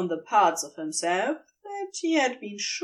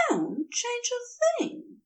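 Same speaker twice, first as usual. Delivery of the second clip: Aziraphale voiced much higher, slow and insistent; insistent and intense; 14 semitones higher, 3 dB quieter, slower than usual. A narrator's reading. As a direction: press it, gently but firmly, as the angel. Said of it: "insistent and surprisingly intense".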